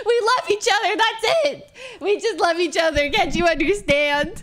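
put-on loud, high weepy drunk pleading; hammed-up tearful indignation; mock sobbing protest. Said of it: "you're a sobbing mess".

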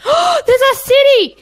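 thrilled discovery, slow and loud; excited gasp. Real, not acted. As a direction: gasp and marvel at it. Discovering a city in game.

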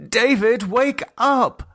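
high, urgent call to wake him. Acted. Lisa urging David awake, high and urgent. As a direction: rouse him, insistent.

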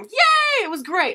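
high, loud squeal of joy; excited cheer. Real, not acted. Fan cheering the speech, high squeal.

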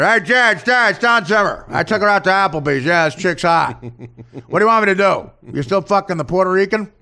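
Don Zimmer. Acted. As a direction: holler at a player like a pal on the phone. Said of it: "And then say to Jeter".

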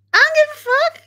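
very high-pitched, jokey dismissal; mock defiant comeback. Streamer answering an imagined commenter, exaggerated high defiance. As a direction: brush off the critic in a squeaky-high voice.

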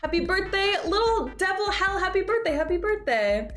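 bright, lifted birthday greeting; cheery birthday wish. Real, not acted. Streamer cheerfully wishes a chatter happy birthday. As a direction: beam it out to them.

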